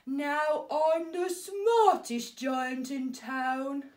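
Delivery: slow, proud, booming giant; proudly. A narrator's reading. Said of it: "he said proudly".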